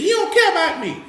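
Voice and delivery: high-pitched imitation of a wounded complainer; mock complaint. Speaker mimicking people bothered that he walked away, mock complaint.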